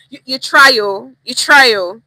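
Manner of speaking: "you try, oh" loud and sarcastic, grudging; side-eye. Shown as a demonstration. Teacher repeats the sarcastic side-eye "you try, oh". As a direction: praise that is not praise. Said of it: "give the side eye".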